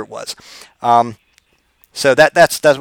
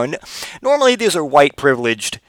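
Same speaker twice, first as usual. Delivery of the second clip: ordinary talk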